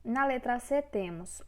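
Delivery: ordinary talk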